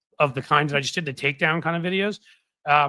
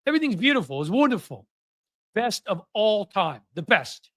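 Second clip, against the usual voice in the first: light New York politician impression, pitched up; Donald Trump; 5 semitones higher than usual. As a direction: lightly mimic a boastful president, raised pitch. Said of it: "I'm better at that than Trump".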